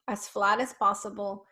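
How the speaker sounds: usual speaking voice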